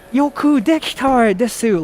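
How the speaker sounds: high imitation of foreigner's accented Japanese. Weeb praising Japanese teammate, imitated accented Japanese. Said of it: "interesting accent".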